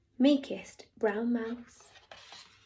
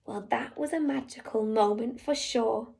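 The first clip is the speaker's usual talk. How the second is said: ordinary delivery, nothing marked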